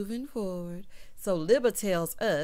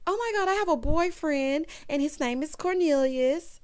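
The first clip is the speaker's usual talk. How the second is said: imitating a giddy crush, a bit higher; mock gushing; 6 semitones higher than usual. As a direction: gush like a smitten girl, lightly. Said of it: "Camille is like".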